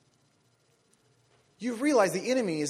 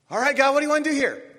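exasperated Moses; 7 semitones higher, 10 dB louder than usual. Retold or imitated. Moses pleading with God.

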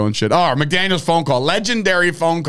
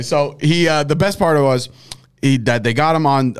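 ordinary delivery

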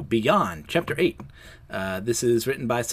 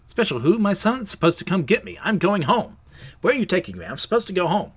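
acted alarmed patient, high and insistent; indignant protest; 7 semitones higher, 3 dB louder than usual. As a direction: protest being taken away, pitched up. Patient protests being taken away.

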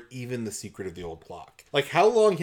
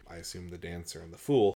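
ordinary delivery

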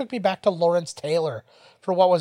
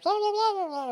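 high garbled disguised voice; mock a disguised voice; masked voice; 3 dB quieter than usual. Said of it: "kind of voice".

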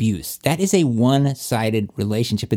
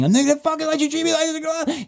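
mock maniac scream. Taped victim screaming at an abuser, mock maniac shriek. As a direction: imitate a maniac screaming, pitched way up. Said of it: "screaming like a maniac".

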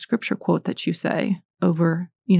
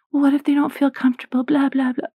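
higher, fast, whiny mock objection; 6 semitones higher than usual. Mocking parents who object, higher and fast and whiny. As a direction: mimic a whiny excuse, then brush it off. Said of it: "whiny lies of the enemy".

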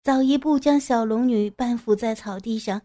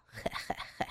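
sly snicker; 16 dB quieter than usual. A narrator's reading. Villain snickers.

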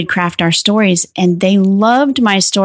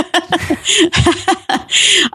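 laugh; 10 semitones higher than usual. Real, not acted. Guest laughs at the question.

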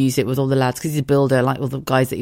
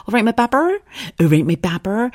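put-on Bristol accent; 8 semitones higher than usual.

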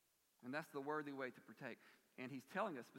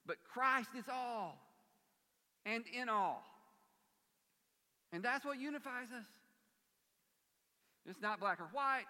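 fervent preaching; 8 semitones higher, 8 dB louder than usual. Acted. Preaching at full force.

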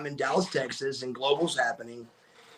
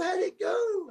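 eager wife; 3 dB quieter than usual. Retold or imitated. Wife greets him at door.

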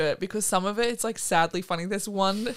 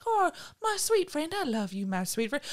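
mock cutesy affection; 8 semitones higher than usual. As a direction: coo over a beloved animal. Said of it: "like, so cute".

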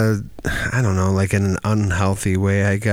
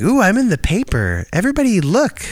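much higher, preening voice; mock showing off; 10 semitones higher than usual. Mocking himself showing off about the newspaper, high. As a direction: show off in a high pitched voice. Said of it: "my voice goes up".

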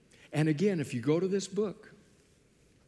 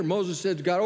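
usual speaking voice

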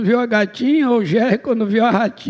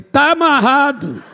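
loud, higher, drawn-out retort; exclaimed rebuke; 4 semitones higher, 4 dB louder, slower than usual. Retold or imitated. Preacher retelling his retort to the voice about marrying, exclaimed rebuke.

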